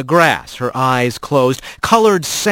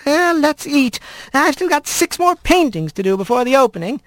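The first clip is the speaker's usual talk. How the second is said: put-on old squirrel grandmother; 11 semitones higher than usual. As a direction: coax him like a fond old granny. Grandmother calls to lunch.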